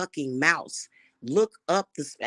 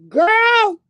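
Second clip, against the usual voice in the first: very high, loud whoop; excited squeal; 9 dB louder than usual. Real, not acted. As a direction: squeal it in delighted admiration. Exclaiming over Idris Elba.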